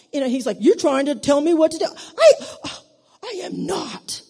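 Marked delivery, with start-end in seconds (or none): 0.6-1.9 loud, raised-pitch imitation of a complaint; mock indignant accusation; accusing. Acting out husband's accusation. 1.9-4.2 high, sputtering indignant denial; hammed-up offended retort; mock outrage. Acted outraged reply to husband.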